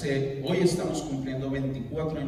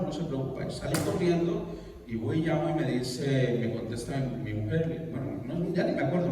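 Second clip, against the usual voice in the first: ordinary talk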